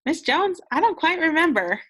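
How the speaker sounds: high, unsure student voice; confused student. Pretend. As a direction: play the puzzled kid. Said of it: "Thumbs down if you're like".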